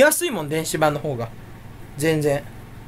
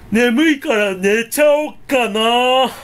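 slow, drawn-out announcement; big loud voice; 3 semitones higher, 4 dB louder, slower than usual. Real, not acted. Streamer pointlessly announces bedtime, big slow voice. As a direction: call it out slowly in a big voice. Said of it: "でかい声で言って".

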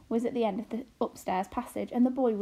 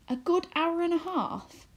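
higher, slow, musing echo; echoing mother's words; 8 semitones higher than usual. A narrator's reading. A girl repeats her mother's words, hatching a plan. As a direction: turn the words over as a plan dawns. Arrietty echoes mother, breathless.

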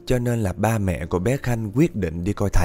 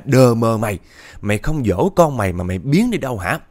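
louder furious curse shouted downstairs; angry yelling; 5 dB louder than usual. Retold or imitated. Husband cursing his wife from upstairs, loud angry yell. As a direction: bark it down from the attic, fed up. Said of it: "chú chửi vọng xuống".

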